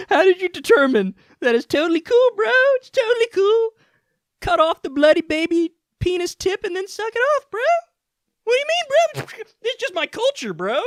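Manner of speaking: high, mocking bro voice; mocking Ben voice. Mocking Ben Shapiro in a high smug bro voice.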